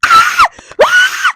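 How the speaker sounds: very high, loud squealing screams; excited fangirl scream. Acted. Reader reacting to the first kiss, excited scream. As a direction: shriek twice at top pitch.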